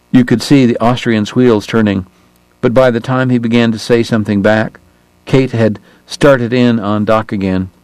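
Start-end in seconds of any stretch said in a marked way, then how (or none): none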